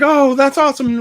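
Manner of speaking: a high, loud burst of excitement; retold cheer at the card show; excited cheer. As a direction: cheer like your buddy just pulled the big card. Friends cheering a rare pull.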